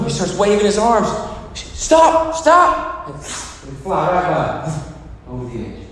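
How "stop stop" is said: high-pitched warning cry to oncoming traffic; acted desperate shout. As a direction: call out urgently to halt a car. Acted man flagging down cars.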